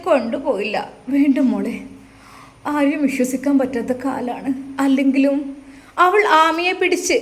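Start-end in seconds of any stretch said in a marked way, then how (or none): none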